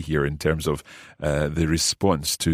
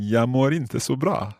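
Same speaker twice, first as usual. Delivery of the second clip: "Jag mår inte så bra" run together faster, higher; quicker try; 7 semitones higher than usual. Shown as a demonstration. Learner imitates the teacher's quick Swedish. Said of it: "I'll try saying that quickly like you".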